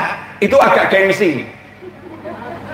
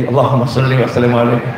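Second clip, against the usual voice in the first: murmured sholawat; 9 semitones lower, faster than usual. Shown as a demonstration. Wife chanting sholawat while massaging.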